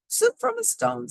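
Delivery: put-on very high old lady voice, amazed. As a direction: pipe it up like a wondering granny. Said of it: "said the little old lady".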